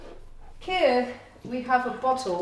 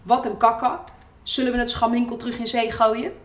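cartoon caption read in broad Haags Dutch, a bit louder; Hague dialect; 3 semitones higher, 4 dB louder, faster than usual. Host reading the bottle cartoon aloud in Haags dialect. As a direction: give the cartoon line a cheeky Hague dialect. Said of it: "I'm doing this in Haags".

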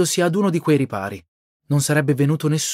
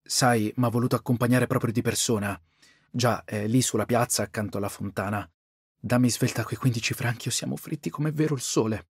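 softer, hushed urgent plea; lowered voice; 4 semitones lower, 7 dB quieter than usual. A narrator's reading. Brother begging his sister in a low, urgent voice. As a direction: keep it down so the clerks can't hear. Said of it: "Jeanne abbassò la voce".

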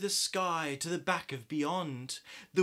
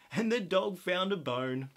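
ordinary delivery

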